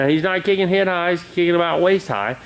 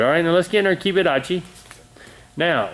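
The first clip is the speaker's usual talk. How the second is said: ordinary talk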